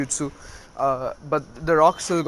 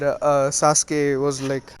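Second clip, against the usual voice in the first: plain reading voice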